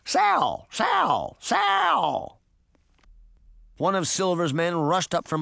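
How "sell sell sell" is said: very high parrot squawks; piercing, repeated bird shrieks; shrieking parrot. A narrator's reading. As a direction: screech each word like a parrot. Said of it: "the pirate's parrot shrieked".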